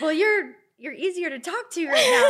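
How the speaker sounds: a playful laughing jab; laughing blurt. Retold or imitated. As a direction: blurt it out while cracking up. Retelling a blurted joke, laughing.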